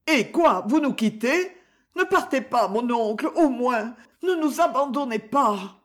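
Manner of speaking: high, urgent plea from a niece; anxious pleading. Acted. Niece begs uncle not to leave. (supplication inquiète, voix haut perchée)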